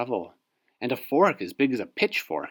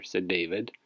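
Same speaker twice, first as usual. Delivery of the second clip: plain reading voice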